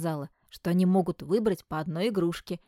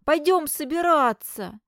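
loud, high and slow urging; insistent call; 9 semitones higher, 7 dB louder than usual. Acted. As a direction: call him up, no giving in. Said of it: "не отступал Клеон".

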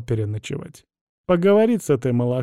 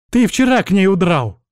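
higher, louder outburst; accusing shout; 6 semitones higher, 5 dB louder than usual. A narrator's reading. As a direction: cry out a jealous accusation. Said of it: "выкрикнула кузина". (обвиняющий выкрик)

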